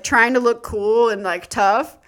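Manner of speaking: ordinary delivery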